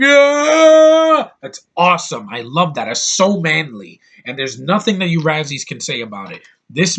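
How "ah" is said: high, loud war yell; imitating Rambo's loud high battle cry. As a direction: scream it like charging on horseback. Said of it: "Him on a horse going".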